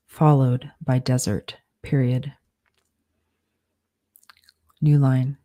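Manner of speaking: slow dictation, punctuation spoken aloud; dictating. Shown as a demonstration. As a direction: dictate it word by word with pauses.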